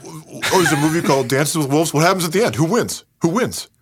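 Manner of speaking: high-pitched, hurried mimicry; mocking impatient questioner. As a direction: needle him by parroting his questions. Mocks friend's cut-to-the-end question.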